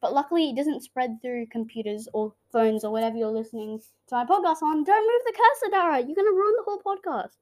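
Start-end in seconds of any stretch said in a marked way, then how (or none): 4.8-7.3 high, raised-voice telling-off; exasperated scolding. Real, not acted. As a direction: scold a meddling brother urgently. Scolding brother for moving cursor.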